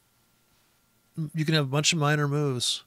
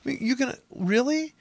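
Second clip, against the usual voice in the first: much higher, unsure imitated trader; mock confused trader; 9 semitones higher than usual. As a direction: play a dithering newbie reading candles. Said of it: "You be".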